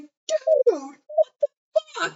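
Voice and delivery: imitating horrified disbelief, very high; high, drawn-out stunned reaction; mock shock. Friend reacting to a sudden neck-snapping, mock shock.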